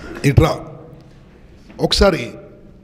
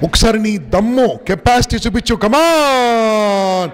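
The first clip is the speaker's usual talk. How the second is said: hyping up; 4 semitones higher, much slower than usual. Real, not acted. Speaker hypes student to jump.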